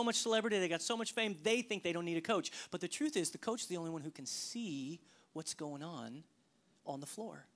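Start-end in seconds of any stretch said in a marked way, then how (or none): 3.3-7.4 soft earnest. Acted. Softening to earnest point.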